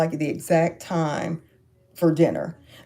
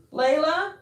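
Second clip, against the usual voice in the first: higher, calling out to a dog in another room; 11 semitones higher than usual. Real, not acted. Owner calling out for the dog Layla who ran off. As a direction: call her name to find her.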